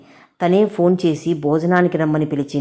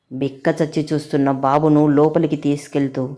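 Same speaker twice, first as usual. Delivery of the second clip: ordinary delivery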